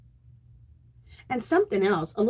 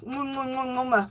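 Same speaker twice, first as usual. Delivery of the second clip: blown kisses; 6 dB quieter than usual. Real, not acted. Blowing kisses to viewer.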